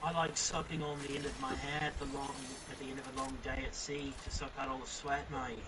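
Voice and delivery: hammed-up pirate captain, low and soft; Jack Sparrow impression. Friends on a call, playing a low pirate. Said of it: "I'm Captain Jack Sparrow".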